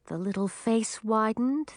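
ordinary delivery, nothing marked

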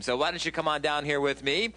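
usual speaking voice

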